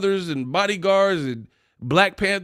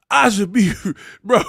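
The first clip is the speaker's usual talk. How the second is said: laughing; 4 semitones higher, 5 dB louder than usual. Real, not acted. Laughing at own joke.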